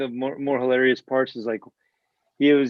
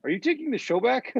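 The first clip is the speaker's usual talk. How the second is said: higher-pitched, flustered and startled; mock alarm; 12 semitones higher than usual. Trevor Noah fearing Stewart takes over, mock alarmed high voice. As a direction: act thrown off, a little panicked. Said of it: "And he's like".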